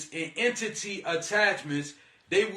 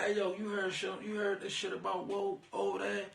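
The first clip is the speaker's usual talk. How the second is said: much softer, conspiratorial whisper-like tone; hushed gossip; 10 dB quieter than usual. Retold or imitated. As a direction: lean in and spill the gossip quietly. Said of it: "they run up to you and be like".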